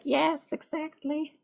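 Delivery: high, slow, soft voice with a touch of haughtiness; a tarot reader voicing her cat Cleo, high and slow; Cleo the cat agreeing. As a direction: voice the cat as a smug little queen. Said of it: "And Cleo is like".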